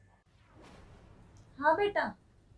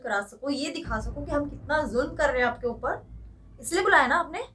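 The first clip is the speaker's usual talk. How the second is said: angry scolding; 2 semitones higher, 4 dB louder than usual. Acted. Daughter-in-law berating her mother-in-law over the ironing, rising to a shout. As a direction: scold her harder and harder until you yell.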